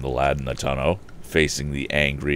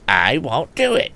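impudent; 10 semitones higher, 5 dB louder than usual. A narrator's reading. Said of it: "retorted the red-haired lad impudently".

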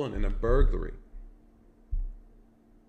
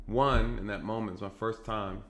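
ordinary talk

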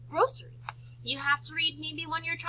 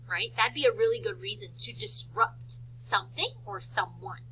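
ordinary delivery

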